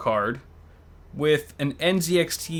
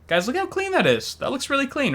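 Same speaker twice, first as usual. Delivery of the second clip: high-pitched excited praise; 12 semitones higher than usual. Real, not acted. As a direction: gush about it in a high voice. Excited about clean build.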